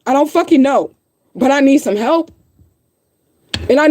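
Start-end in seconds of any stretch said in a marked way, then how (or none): none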